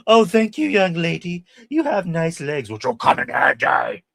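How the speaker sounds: high, loud hammed-up old lady; high mock-sweet granny menace; possessed grandma voice. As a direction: coo like a creepy grandma, building. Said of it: "where she's like".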